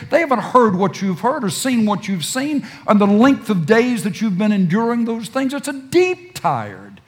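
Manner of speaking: rising sermon fervor; impassioned preaching. Acted. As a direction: build it up like a pulpit appeal. Preacher builds to full force.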